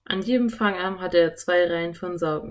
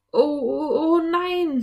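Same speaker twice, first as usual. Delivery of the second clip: high, louder stuttering dismay; frightened stammer; 10 semitones higher, 5 dB louder, slower than usual. A narrator's reading. Philipp reading that sharks are coming, frightened stammer. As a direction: stumble over it in alarm. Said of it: "stammelte Philipp".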